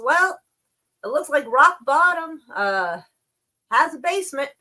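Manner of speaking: high, loud bright quip; girl's jokey quote. Retold or imitated. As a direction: toss off a cheeky one-liner. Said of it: "And she goes".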